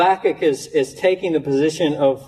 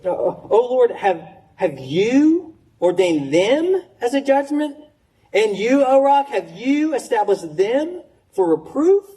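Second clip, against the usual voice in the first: higher, slow, searching questions to God; pleading question; 6 semitones higher, slower than usual. Acted. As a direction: press the point, each line a raised question. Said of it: "you have to read these as questions".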